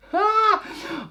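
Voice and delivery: high, loud burst of laughter; sudden laugh. Real, not acted. Vlogger laughing at her burn mark, high loud laugh.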